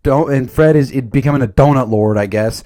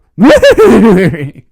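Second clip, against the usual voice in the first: loud laugh; 5 semitones higher, 10 dB louder than usual. Real, not acted. Laughing at own Scooby quote.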